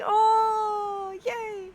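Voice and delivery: a high, squeaky little cheer acting out her own delight. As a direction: squeal it like a happy fan. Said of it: "I'm just like".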